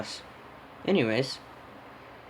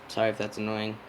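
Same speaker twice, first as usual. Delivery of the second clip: usual speaking voice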